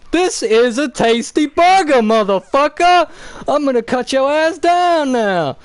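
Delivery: high, loud badass yell at the villain; Mace Windu shouting. Mace yelling his threat at Palpatine, loud and high. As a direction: roar the threat like a movie tough guy. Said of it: "Mace is like".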